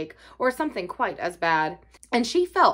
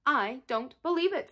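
slightly sour, softer and higher disbelief; 4 semitones higher, 7 dB quieter than usual. A narrator's reading. Mary doubting Colin's claim to be dying, mildly sour. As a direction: doubt it sourly, without raising volume. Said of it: "said Mary sourly".

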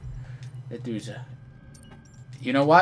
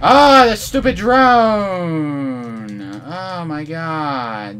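slow, stretched frustrated complaint; drawn-out groan; 6 semitones higher, 9 dB louder, much slower than usual. Real, not acted. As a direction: drag the words out in despair.

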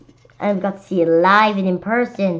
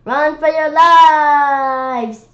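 long slow shout, higher and louder; 7 semitones higher, 8 dB louder, much slower than usual. A narrator's reading. New kid Neil warning everyone, slow shout. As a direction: drag out the alarm call. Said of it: "shouted Neil".

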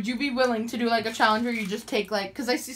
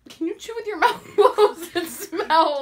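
laughing; 8 semitones higher, 6 dB louder, slower than usual. Real, not acted. Laughing while asking.

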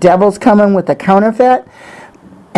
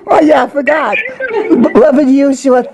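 high, slow praise between laughter and sobs; laughing through tears; 7 semitones higher than usual. Real, not acted. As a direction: overcome with joy as you praise. Overwhelmed praise, laughing and crying.

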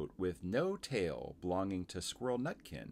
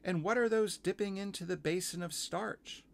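put-on high, curious little-girl question; Lucy; 11 semitones higher than usual. Girl asking about the starch basin, high-pitched. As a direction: ask in a high, childlike voice. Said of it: "said Lucy".

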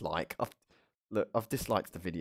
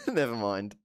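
ordinary talk, nothing marked

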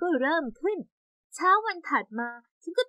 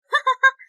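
short triumphant high laugh; gleeful laugh; 11 semitones higher, 6 dB louder than usual. Acted. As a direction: laugh in glee at your revenge. Revenge prank succeeded.

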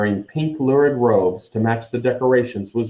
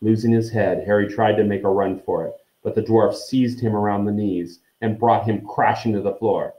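usual speaking voice

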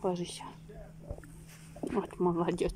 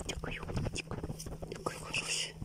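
whispering; 7 dB quieter than usual. Real, not acted. Cooing to the dog.

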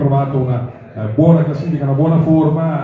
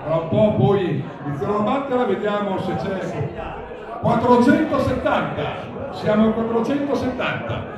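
raised, ringing bid calls; auctioneer calling bids; 7 semitones higher than usual. Real, not acted. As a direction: call out each bid to the room.

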